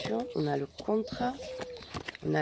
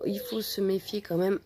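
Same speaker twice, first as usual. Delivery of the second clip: ordinary talk, nothing marked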